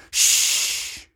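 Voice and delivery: sharp, drawn-out shush; loud shush. A narrator's reading. Father leaning forward to hush talkers, loud shush. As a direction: shush the people in front. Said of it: "lean forward and say".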